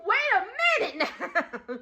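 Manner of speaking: high, disbelieving retort; incredulous reaction. Retold or imitated. Storyteller questioning a shower claim, incredulous. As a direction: stop them short in disbelief.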